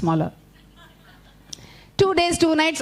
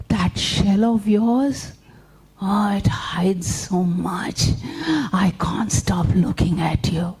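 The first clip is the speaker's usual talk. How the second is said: flattering spider; much slower than usual. Acted.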